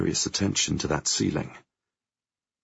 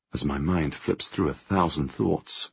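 ordinary delivery, nothing marked

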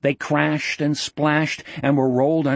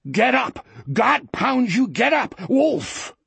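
high-pitched desperate cursing; frantic angry yell; 9 semitones higher, slower than usual. A narrator's reading. Wolf hauling at his drowning animal, frantic angry yell.

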